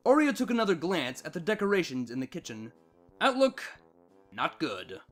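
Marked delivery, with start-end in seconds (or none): none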